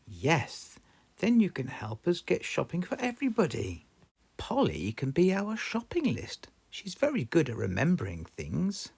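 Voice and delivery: high kindly granny voice; cheerful grandma explaining; Granny Pig voice. Acted. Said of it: "Granny Pig said".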